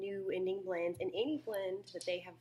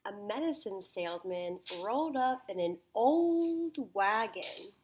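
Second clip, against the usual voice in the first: put-on reading aloud with "old" stretched and loud; emphasis on old; 2 semitones higher, 6 dB louder than usual. Shown as a demonstration. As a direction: read it to a class, hitting "old" long and hard. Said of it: "emphasize the word".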